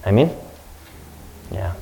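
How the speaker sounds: usual speaking voice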